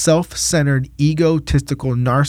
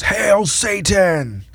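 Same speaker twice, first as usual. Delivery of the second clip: higher, long drawn-out mock war cry; in-your-face taunt; 5 semitones higher, much slower than usual. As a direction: imitate a defiant heckler, stretching each word. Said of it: "he gets in my face, and he goes".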